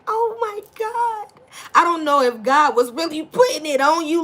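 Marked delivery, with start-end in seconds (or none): 0.1-1.3 mock shock. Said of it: "you was just like".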